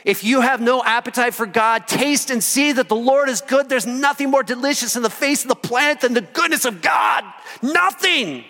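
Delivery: carried away by joy; excited preaching. Real, not acted. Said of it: "I'm getting a little excited".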